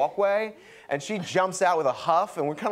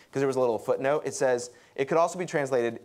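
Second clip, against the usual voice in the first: ordinary talk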